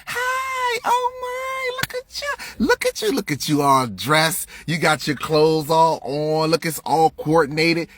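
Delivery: fake-delighted teacher fussing over a kid's outfit; hammed-up teacher cooing over clothes; mock gushing teacher. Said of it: "how the teacher started the".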